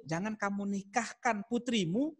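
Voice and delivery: ordinary talk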